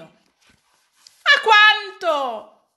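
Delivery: very slow, high, loud query; anxious question. A narrator's reading. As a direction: ask the price in dismay. Maid asks price. (domanda ansiosa, lentissima e acuta)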